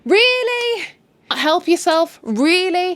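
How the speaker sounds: high, loud disbelieving really; mock outrage, voice leaping up; incredulous. Real, not acted. Vlogger reacting to the flirting, high and incredulous. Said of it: "And I'm like".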